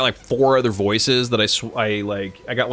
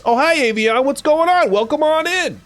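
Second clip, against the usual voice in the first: high excited greeting; 5 dB louder than usual. Real, not acted. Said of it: "greeting chat in high voice".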